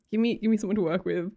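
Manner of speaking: ordinary talk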